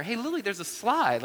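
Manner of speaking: put-on high-pitched call to a small child; dad coaxing. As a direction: imitate a parent pointing something out to a toddler. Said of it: "I start telling her".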